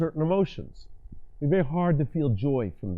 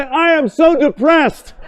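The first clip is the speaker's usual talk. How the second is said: much higher, louder, slow, joking; mock depressed, laughing high; 14 semitones higher, 15 dB louder, slower than usual. Shown as a demonstration. Coach demos saying depressed words while elated, high and loud. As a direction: squeal a fake complaint while beaming up. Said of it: "Look up there and say".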